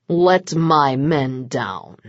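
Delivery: low, slow, edged command; with an edge. A narrator's reading. Vlad orders Mencaries to release his men, slow and edged. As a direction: lean on each word with a hard edge. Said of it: "with an edge to his voice".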